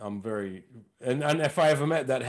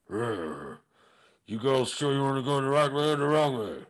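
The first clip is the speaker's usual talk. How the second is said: Tom Hardy as Mad Max. Retold or imitated. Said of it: "here's my impression of Tom Hardy in Mad Max".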